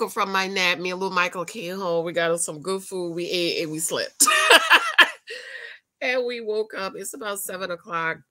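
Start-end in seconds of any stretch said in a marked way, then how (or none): 4.3-5.7 loud burst of laughter; high laugh. Real, not acted. Laughing after story.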